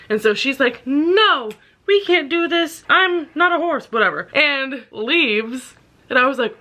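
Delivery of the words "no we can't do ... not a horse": high, hammed-up refusal; mock refusal. Woman turning down the horse man, high mock refusal. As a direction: pitch it up as an imitated protest.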